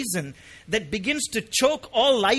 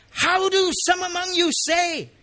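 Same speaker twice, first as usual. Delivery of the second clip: high, loud, incredulous question; shocked surprise; 10 semitones higher, 5 dB louder than usual. Acted. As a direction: exclaim it in raised-voice disbelief. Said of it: "it's an expression of surprise".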